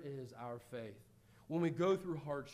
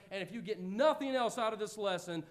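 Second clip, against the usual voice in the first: ordinary delivery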